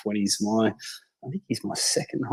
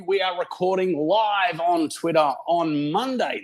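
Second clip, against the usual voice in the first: podcast intro read; 4 semitones higher than usual. Acted. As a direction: open the show like a radio host. Podcast host opens the show.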